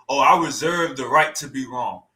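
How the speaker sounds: a low, dopey mock of the debater; goofy mock quote. Streamer mocking the debater's line in a low goofy voice. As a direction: mimic him as a fool, pitched low. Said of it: "say some goofy ass shit like".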